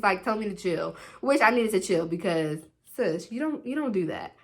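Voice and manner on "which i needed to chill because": ordinary talk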